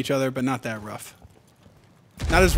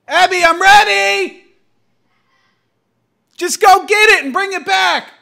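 calling out; 14 dB louder than usual. Real, not acted. Calling out to wife offscreen.

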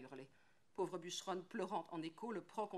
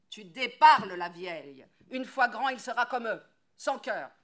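far louder, higher, harsh scolding; furious yell; 4 semitones higher, 19 dB louder than usual. Acted. Woodcutter yelling at his old wife. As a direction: cut her off with rage.

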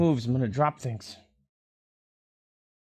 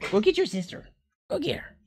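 higher, playful urging; high egging on; 7 semitones higher than usual. Real, not acted. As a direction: hype the dog up. Egging dog on.

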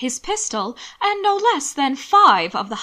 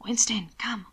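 hushed whispered summons. A narrator's reading. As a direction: whisper to call the dog away. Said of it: "for a shadow ... to whisper".

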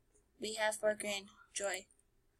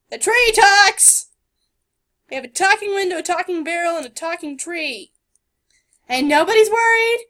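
loud high disbelief; incredulous; 5 semitones higher, 11 dB louder than usual. Real, not acted. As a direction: rant in disbelief. Incredulous rant.

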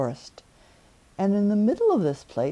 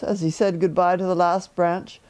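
ordinary delivery, nothing marked

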